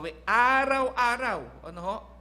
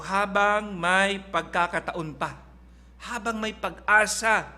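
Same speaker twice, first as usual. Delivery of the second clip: slow, louder, every word pressed; emphatic preaching; 2 semitones higher, 5 dB louder, slower than usual. Acted. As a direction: hammer the phrase home from the pulpit. Preacher drives home the point.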